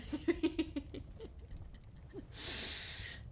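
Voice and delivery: high, breathless giggle; giggling. Real, not acted. Planning conversations on partner's lap, giggling. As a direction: dissolve into a long high giggle.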